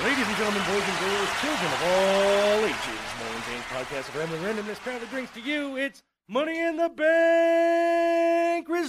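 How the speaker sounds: booming ring announcer, slowing at the end; grand entrance announcement. Pretend. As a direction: announce it like a wrestling arena host. Ring announcer introduces the results.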